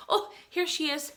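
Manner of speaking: plain reading voice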